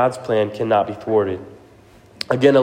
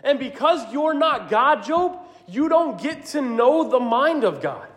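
high, firm rebuke as God; God rebuking; 10 semitones higher than usual. Acted. God rebukes whiny Job, high and firm. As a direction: set Job straight from above. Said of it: "He's saying".

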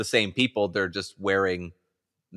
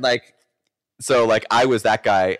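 usual speaking voice

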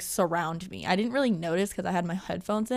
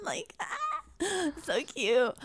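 higher, softer dreamy gush; breathy-free mock squeal of delight, much higher and softer; mock swooning; 7 semitones higher, 5 dB quieter than usual. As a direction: gush like a lovestruck girl over a soulmate. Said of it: "it sounds like".

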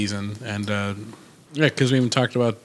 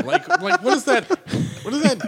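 laughing. Real, not acted. Laughing at friend's teasing.

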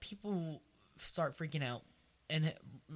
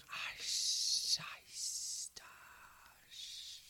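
imitating Parseltongue with long hisses; whispered hissing that dies away; hissing snake sound; 3 dB quieter, much slower than usual. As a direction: hiss like a snake, fading. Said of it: "everyone, it's like".

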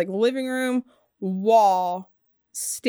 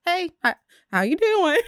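high, bright friendly hello; chirpy casual hi; cheery greeting; 8 semitones higher than usual. Retold or imitated. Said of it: "Not like that".

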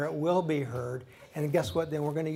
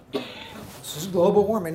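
mock claim; 3 semitones higher, 6 dB louder than usual. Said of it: "mocking the claim again".

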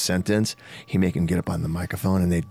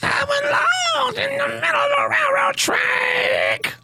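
high screechy rock wail; slower than usual. Shown as a demonstration. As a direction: belt it like a cartoon rocker. Singer demos [name] style.

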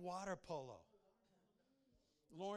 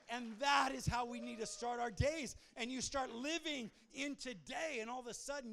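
loud, high, fervent sermon delivery; full-force preaching; 4 semitones higher, 6 dB louder than usual. Real, not acted. Preacher urging the congregation to start each day with God, full-force preaching. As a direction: preach it loud, driving up to big peaks.

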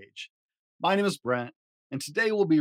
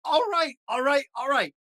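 exasperated; 12 semitones higher, 4 dB louder than usual. Acted. Grover exasperated.

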